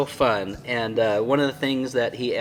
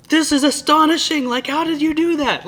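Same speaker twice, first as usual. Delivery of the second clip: much higher and louder, astonished cry; amazed exclamation; 8 dB louder than usual. Retold or imitated. Amazed reaction to red and brown thread. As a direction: gasp it out in wonder at the stitching. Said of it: "I'm like".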